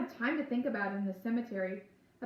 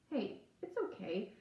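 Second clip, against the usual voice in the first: slow, softer reassurance; gently calm her down; softening; 5 dB quieter, slower than usual. A narrator's reading. Groundskeeper soothing an upset girl, soft and slow. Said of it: "His weather face softens".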